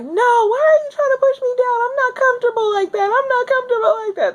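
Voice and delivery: high, crying yell of protest; pained yelling. Retold or imitated. In labor, yelling at the nurse pushing her down. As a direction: push back through the pain, crying out. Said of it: "This is me yelling".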